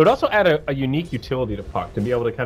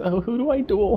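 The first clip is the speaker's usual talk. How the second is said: mock scared voice; 9 semitones higher than usual. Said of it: "I like your scared voice".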